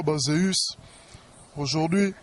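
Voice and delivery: usual speaking voice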